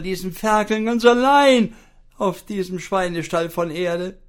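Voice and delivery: shrieking wife. Acted. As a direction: screech in panic at the attacker. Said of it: "zeterte und keifte sie".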